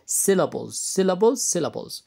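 syllables said three times, clearly drilled; repeat to drill. Shown as a demonstration. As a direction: drill the word syllables for the class. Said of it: "إيش المقاطع الصوتية". (نطق syllables بوضوح للتكرار)

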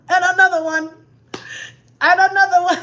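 announcer-style shout. Real, not acted. Listing more babies.

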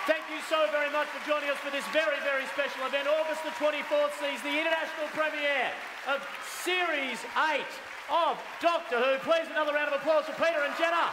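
loud, high-pitched event sign-off; announcer's shout. Real, not acted. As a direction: shout it over the applause to close the show. Host shouts sign-off over applause.

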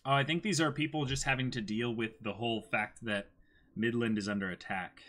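usual speaking voice

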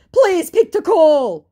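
high, loud, drawn-out plea; mock pleading. Scammer begging her to answer, mock pleading. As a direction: beg in hammed-up desperation.

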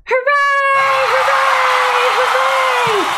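high, loud hooray; cheering. Pretend. Leader cheering with children as the stones, shouting high. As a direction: cheer at the top of your voice. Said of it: "Let's get ready to cheer".

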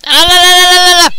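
loud high la la; frantic sing-song burst; spazzing out. Real, not acted. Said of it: "I was, like, spazzing out there".

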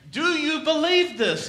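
asked high and loud; earnest question. Acted. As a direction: press the question on them. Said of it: "he asked".

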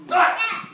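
high, very loud "ah"; sudden martial arts shout; demonstration yell. Shown as a demonstration. Coach showing student what to say, high explosive yell. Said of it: "Oh, say this one".

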